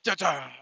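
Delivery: low, soft mock dun dun; dramatic sting hum. Host mocks the documentary's music with a soft dun dun. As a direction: hum a quiet ominous sting. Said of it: "needle drop".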